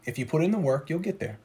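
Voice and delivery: quiet, steady promise; soft reassurance. Acted. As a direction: reassure them gently.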